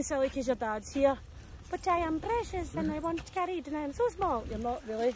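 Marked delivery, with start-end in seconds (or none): 1.7-4.4 lightly voiced, pleading little dog; voicing the dog. Dog walker lightly voicing a dog that wants carrying. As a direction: play the small dog begging to be carried.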